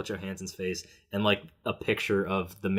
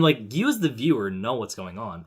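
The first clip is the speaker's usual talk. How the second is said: usual speaking voice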